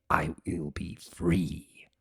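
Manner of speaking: low, slow whisper; whispered vow. Acted. Discord whispering his promise of freedom. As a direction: hiss the promise softly.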